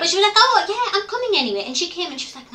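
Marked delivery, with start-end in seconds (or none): 0.4-1.6 much higher, bright mock friend's voice; imitating her friend. Friend on the phone hearing her cry, in a high imitating voice. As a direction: squeak it out like the friend covering.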